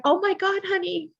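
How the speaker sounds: high, startled cry of sympathy; shocked sympathy. Retold or imitated. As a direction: gasp it out like a caring nurse hearing bad news. Nurses react to her diagnosis.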